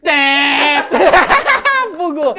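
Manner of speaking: bem alto e agudo, rindo; grito zombeteiro de vitória. Real, not acted. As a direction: solte um grito de quem pegou o outro no erro. Comemora erro no jogo. (gritando, caindo na risada)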